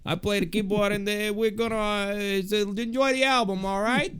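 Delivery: high, hammed-up New York wiseguy; mock New York band member greeting fans; New York accent. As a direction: ham up a Brooklyn wiseguy introducing himself. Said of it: "very deep New York accent".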